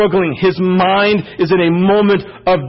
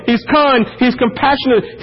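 ordinary delivery

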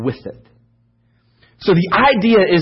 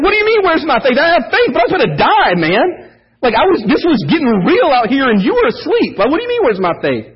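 a preacher playing himself, defensive and high-pitched; indignant protest; 8 semitones higher than usual. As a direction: pitch it up in mock outrage, can't believe the question. Speaker imagines protesting to Jesus.

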